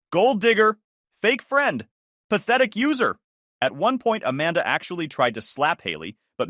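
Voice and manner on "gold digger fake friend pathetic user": higher, louder screamed insults; screaming insults. A narrator's reading. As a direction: scream over each other in a fight. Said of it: "They started screaming over each other".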